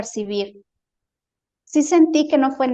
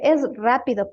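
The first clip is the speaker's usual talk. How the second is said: normal talking voice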